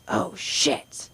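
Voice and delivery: put-on breathy whisper of a kid's slip; whispered oh shit. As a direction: let it slip under the breath. Kid swears after bowling.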